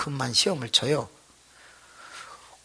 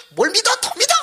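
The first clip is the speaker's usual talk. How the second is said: a much higher, shrieking demand; zealous scolding yell; 3 dB louder, faster than usual. Retold or imitated. Deaconess hitting the boy, demanding more faith, high yell. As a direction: push him harder like a fanatic deaconess.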